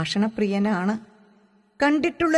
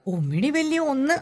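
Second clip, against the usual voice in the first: higher-pitched jibe with a touch of sharpness; silent fierce taunt; 6 semitones higher than usual. A narrator's reading. Suhra taunts Majeed from behind the door, sharply. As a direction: mouth it fiercely at him, half-voiced. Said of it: "അല്പം രൂക്ഷത പ്രദർശിപ്പിച്ചിട്ടു ശബ്ദമുണ്ടാക്കാതെ പറയും".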